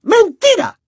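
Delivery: high, quick snapping accusation; sharp shout. Acted. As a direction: snap the word like a slap. Umbridge snaps accusation.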